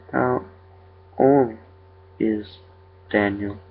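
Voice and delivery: eerie creature, one word at a time. A narrator's reading. Creature repeats its question to the father, haltingly eerie.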